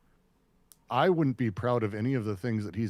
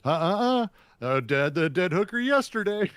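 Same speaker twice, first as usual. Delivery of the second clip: stammering movie line, pitched up; lightly mimicked flustered actor; imitated Ben Affleck; 5 semitones higher, 3 dB louder than usual. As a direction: hem and haw, climbing higher to the end. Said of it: "He goes".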